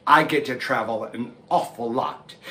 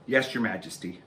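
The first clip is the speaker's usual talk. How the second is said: soft lower meek answer; timid reply; 6 semitones lower, 6 dB quieter than usual. Acted. Frightened animal answering the lion, soft and meek. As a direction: cower before the king. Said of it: "everyone was so afraid".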